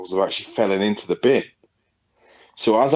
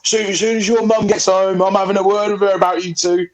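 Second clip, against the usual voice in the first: mimicking neighbour John; 9 semitones higher than usual. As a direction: squeak it out like a nagging old neighbour. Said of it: "he'd be like".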